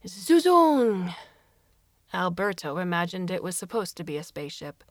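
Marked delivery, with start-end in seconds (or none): 0.0-1.1 alien voice. Acted. Alien exclaims in story.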